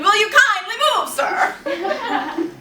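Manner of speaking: high, loud exasperated demand; acted outburst at a stranger; comic scream. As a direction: belt out the polite words as a shriek.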